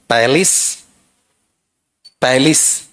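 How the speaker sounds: "पैलेस" as PAL-is, short second vowel; careful PAL-is for "पैलेस", rising slightly; correct palace pronunciation. Shown as a demonstration. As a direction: model "पैलेस" with open first vowel, light ending. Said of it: "बताओ क्या रहेगा".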